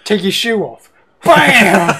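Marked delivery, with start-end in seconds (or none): 1.2-2.0 loud laughing whack sound; shouted with laugh. Acted. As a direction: yell it then crack up. Acting out hitting with shoe.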